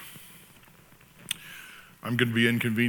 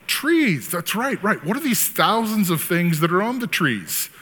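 high, pleased lawyer leading the witness; mock courtroom questioning; 7 semitones higher, 4 dB louder than usual. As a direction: play a lawyer coaxing out answers in a raised pitch. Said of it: "just keep yelling it out".

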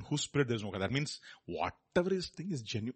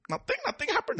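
mock denial. Mocking denial again.

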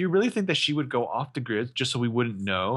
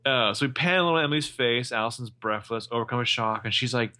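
ordinary talk, nothing marked

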